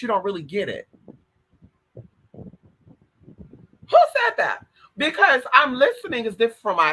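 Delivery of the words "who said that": 1 incredulous. Real, not acted. Incredulous mock outrage.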